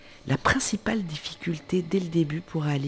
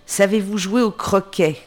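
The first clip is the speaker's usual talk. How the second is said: higher, louder demanding shout; louder high shout of the queen; 5 semitones higher, 6 dB louder than usual. Acted. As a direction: call across the garden. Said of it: "la reine crie".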